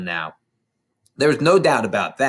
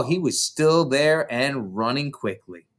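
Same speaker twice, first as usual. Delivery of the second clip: ordinary delivery, nothing marked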